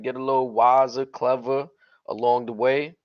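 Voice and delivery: ordinary delivery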